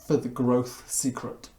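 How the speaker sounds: normal talking voice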